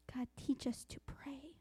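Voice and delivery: very slow, soft whisper to God; whispered prayer. Acted. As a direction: breathe the prayer out, fading away.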